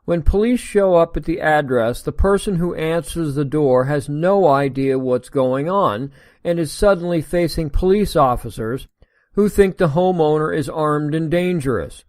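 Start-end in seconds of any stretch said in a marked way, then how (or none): none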